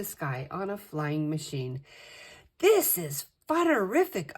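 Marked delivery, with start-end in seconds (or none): 2.6-4.3 high, loud cry of joy; thrilled scream. A narrator's reading. Girl on a flying machine, screaming with joy. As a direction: scream it out with delight. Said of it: "I screamed".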